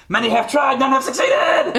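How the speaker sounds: high, loud mock-heroic shout; triumphant boar yelling over its shoulder; echoing boast. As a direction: proclaim it as you flee. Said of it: "and it says".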